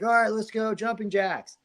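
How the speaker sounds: high, peppy trainer voice; mock instructor call. Taking over the class, high peppy instructor call. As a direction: bark it like a fitness instructor.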